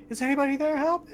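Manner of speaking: high, frantic cry for help. Acted. Game master voices trapped voice in septic tank, frantic. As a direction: call out in a thin, very high panic. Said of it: "starts to sound more frantic".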